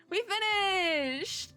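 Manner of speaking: high, slow stretched cheer; excited. Real, not acted. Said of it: "repeated in a different way".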